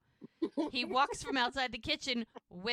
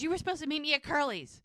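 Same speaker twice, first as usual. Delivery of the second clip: quick, high, louder retold complaint; annoyed accusation; 6 semitones higher, 5 dB louder, faster than usual. Lizzie scolds Parker about meeting at Curly's, annoyed. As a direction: imitate someone coming in hot, fast and pointed. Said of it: "She is not happy".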